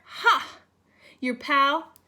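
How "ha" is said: gloating ha. A narrator's reading. Gloating Orange Crayon.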